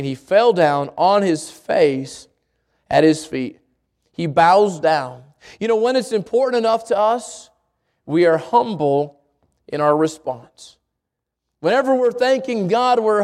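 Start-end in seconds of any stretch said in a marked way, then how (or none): 0.0-5.2 slow and solemn. Acted. Preacher dwells on bowing down. 8.0-10.8 slow humble emphasis. Acted. Preacher slows on humility.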